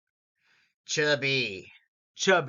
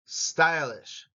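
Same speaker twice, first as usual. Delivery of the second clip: ordinary talk, nothing marked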